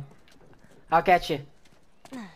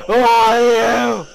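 slow, very loud "Who are you"; drawn-out yell; 3 semitones higher, 8 dB louder, much slower than usual. Real, not acted. Player demanding a stranger's identity, long loud yell. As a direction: bellow it, stretching each word.

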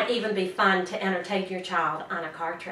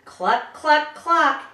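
louder clucking like a hen; mock hen cluck; 6 semitones higher, 5 dB louder, much slower than usual. To the child, a hen riddle clue, playful clucks. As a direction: cluck like a busy chicken. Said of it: "animal riddles that you might like to do with me".

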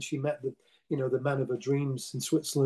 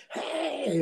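much higher mock stage yell of Hey; stage scream; 11 semitones higher than usual. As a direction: call out Hey to the crowd like on stage. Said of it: "she went through my screams".

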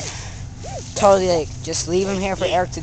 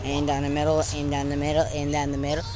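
ordinary talk

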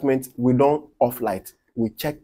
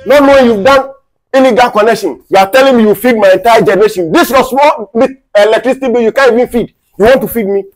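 loud, high, outraged tirade; indignant, high-pitched dressing-down; furious scolding; 8 semitones higher, 10 dB louder than usual. Acted. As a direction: shout insults back at the bragging culprit. Hurls insults back at the culprit.

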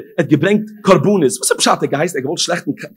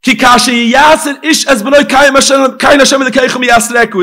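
shouted verse; 8 semitones higher, 9 dB louder than usual. Acted. Preacher repeating the verse to drive it home, shouted. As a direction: call the verse out with full force.

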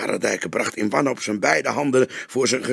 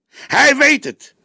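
slower, much higher, louder repeat; young detective insisting harder, emphatic; more insistent; 11 dB louder, slower than usual. A narrator's reading. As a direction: hammer it home.

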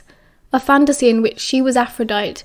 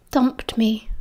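dejected whisper; 3 dB quieter than usual. Acted. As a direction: breathe it out, crushed. Glum reply, whispered.

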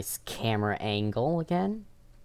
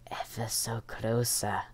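tense hushed whisper; 5 dB quieter than usual. Pretend. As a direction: breathe it, hiding. Seeker approaches hiding player.

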